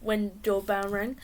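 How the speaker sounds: usual speaking voice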